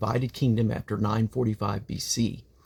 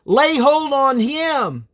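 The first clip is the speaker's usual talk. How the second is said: much higher and louder, slow barked order; royal command shout; 9 dB louder, much slower than usual. Acted. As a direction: seize him, shouted as a furious king. King orders prophet seized.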